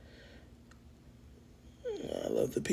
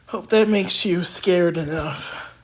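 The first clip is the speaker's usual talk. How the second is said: dramatic proclamation; 8 dB louder than usual. Acted. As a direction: announce the end of the world. Mock doom over sudden snow.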